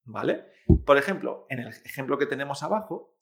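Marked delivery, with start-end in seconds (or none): none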